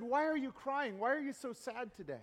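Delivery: soft, concerned friend asking; hushed sympathetic question. Acted. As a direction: quietly ask your crying friend what's wrong. Friend gently asks crying man.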